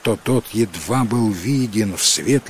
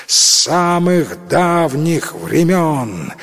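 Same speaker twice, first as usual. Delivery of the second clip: proud proclamation; 5 semitones higher, slower than usual. Acted. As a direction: proclaim it like a prince. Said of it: "гордо провозгласил принц".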